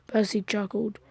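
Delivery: ordinary delivery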